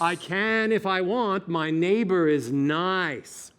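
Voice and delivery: slow, full intoned phrases; drawn-out steady tone; sustained voice exercise. Shown as a demonstration. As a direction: demonstrate a vocal drill with held vowels. Coach demonstrates voice drill.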